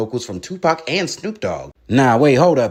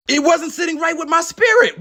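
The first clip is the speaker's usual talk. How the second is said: defensive shout; 12 semitones higher than usual. Acted. Defensive outburst to interviewer.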